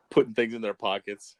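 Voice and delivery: ordinary talk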